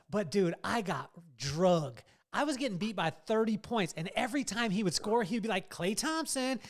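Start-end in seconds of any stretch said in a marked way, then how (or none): 5.7-6.6 dad imitating his son's gleeful trash talk, high and loud; gloating kid. Son taunts dad while winning at NBA 2K, high gleeful crowing. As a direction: pitch up and crow over each basket.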